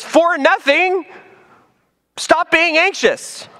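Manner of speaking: high and slow For nothing, stop being anxious; emphatic reading. Shown as a demonstration. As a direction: deliver the command high and slow. Emphatic rendering of the Greek.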